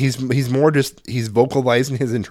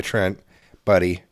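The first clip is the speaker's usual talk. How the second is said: gentle fatherly; 5 semitones lower than usual. Retold or imitated. Father gently stopping son.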